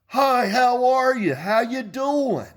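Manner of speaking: very high, slow sing-song greeting; mock a gushing female AI, drawn out and loud; exaggerated high-pitched welcome; friendly AI assistant. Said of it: "she'd go".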